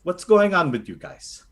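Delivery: lightly voiced doting Filipino grandmother; a hint of a fussing lola reassuring the party; grandma Lola Carly. Lola Carly fussing over the recruits, lightly voiced.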